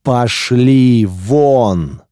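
long commanding call to get out; drawn-out shout. A narrator's reading. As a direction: stretch each word in a long bellow. Guardian shouts them away.

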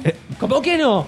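indignant. Real, not acted. Indignant at friend's dismissal.

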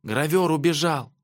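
put-on high, small child's voice; thin high voice of a little girl; faint little voice. A narrator's reading. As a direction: report a loss forlornly. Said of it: "еле слышный голос".

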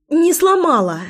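high loud protesting cry; exclaiming. A narrator's reading. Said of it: "воскликнула Лой".

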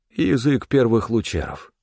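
usual speaking voice

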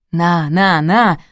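usual speaking voice

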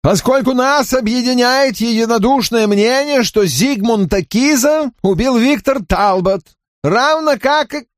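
high nasal-free sneering man; unpleasant voice. A narrator's reading. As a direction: speak with a grating edge. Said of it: "вещал он весьма неприятным голосом".

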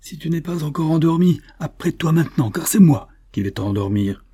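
thundering voice. A narrator's reading. Boatman threatening the waking stranger, thundering. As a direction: bellow it over the helpless victim. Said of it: "lui cria-t-il d'une voix de tonnerre".

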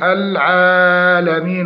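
العالمين with the first long a stretched too far; over-lengthened alif. Shown as a demonstration. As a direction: overstretch the aa in العالمين, as a mistake. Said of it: "الزيادة في مد". (العالمين بزيادة مد الألف)

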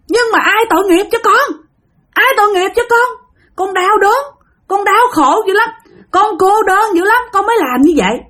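high-pitched imitated lament; retold high, plaintive wail; tearful pleading. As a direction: act out a suffering spirit begging for pity. Spirit pleads its suffering in story.

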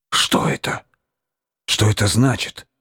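scared hushed question; frightened whisper. A narrator's reading. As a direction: whisper it in fear. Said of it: "испуганно зашептал зоолог".

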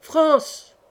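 high rallying cry; shout. A narrator's reading. Young rider gallops into the courtyard, shouting. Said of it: "entra dans la cour en criant".

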